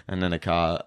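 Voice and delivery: ordinary delivery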